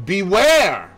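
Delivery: loud, high warning; fierce warning. Acted. Poirot fiercely warns Hastings, loud and high. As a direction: wag a finger and warn. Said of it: "shook his forefinger so fiercely".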